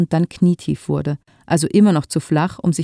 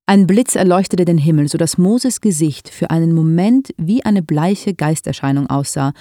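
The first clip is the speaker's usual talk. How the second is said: ordinary delivery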